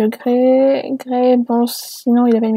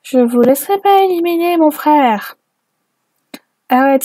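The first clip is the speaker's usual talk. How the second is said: ordinary talk, nothing marked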